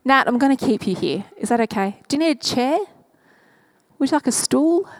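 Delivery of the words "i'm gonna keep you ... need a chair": ordinary delivery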